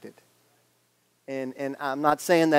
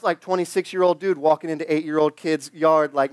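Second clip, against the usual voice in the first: ordinary delivery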